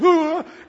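loud, high "hoo" swoop; imitated swooping noise. Pastor showing the bat flying everywhere, imitated whoosh.

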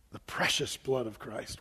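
reverent hush. Acted. Reverent quote of Peter.

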